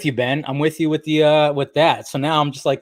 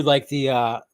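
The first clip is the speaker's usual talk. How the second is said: ordinary talk, nothing marked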